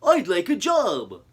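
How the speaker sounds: out of breath request; panting. Acted. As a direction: pant the words. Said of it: "he panted to the chief".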